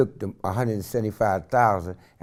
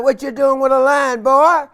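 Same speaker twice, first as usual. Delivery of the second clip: high, loud country drawl of a farmer; old farmer voice; 14 semitones higher, 9 dB louder, slower than usual. Retold or imitated. Farmer in a hay truck calling to a running man, country voice. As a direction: call out from the truck.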